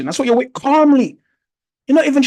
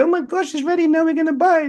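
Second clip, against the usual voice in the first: higher, softer mock YouTube trader gushing; breathless mock excitement; mock excited; 7 semitones higher, 3 dB quieter than usual. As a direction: imitate an overeager trader about to buy. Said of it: "They're like".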